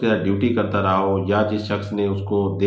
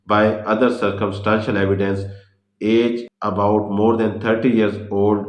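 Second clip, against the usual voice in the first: very slow, word by word, as if dictating notes; slow heading read; 3 dB louder, much slower than usual. Shown as a demonstration. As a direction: spell out the heading slowly so students can write it. Reading out a heading slowly. (धीरे धीरे शीर्षक लिखवाना)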